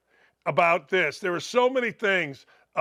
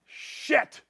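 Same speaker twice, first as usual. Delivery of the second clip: ordinary talk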